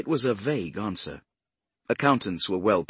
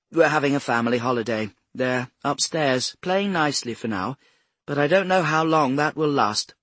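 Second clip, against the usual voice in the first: higher-pitched Mrs Swift character, polite chatter; cultured older woman; 6 semitones higher, 6 dB louder than usual. A narrator's reading. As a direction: play a well-spoken hostess, pitched up. Voices Mrs Swift in the dialogue.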